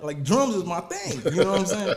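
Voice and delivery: laughing while talking; chuckling through the words. Real, not acted. Laughing at the drums comment.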